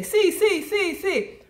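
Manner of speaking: high, chirpy "See, see, see, see" as a quick Italian sì; eager yes. Retold or imitated. Tourist nodding along to a fast-talking doctor, chirpy high yeses. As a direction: rattle off "See, see" high and bright to fake understanding. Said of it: "I just say".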